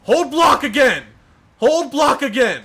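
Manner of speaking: high, loud angry complaint; exasperated yell. Real, not acted. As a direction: yell it at the screen. Frustrated at enemy.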